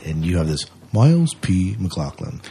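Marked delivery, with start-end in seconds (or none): none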